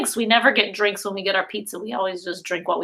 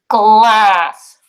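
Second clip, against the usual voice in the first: loud, drawn-out word; loud through the tube; 9 dB louder than usual. Shown as a demonstration. Teacher calling a word into a tube for pre-K. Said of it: "Did you hear me say glass?".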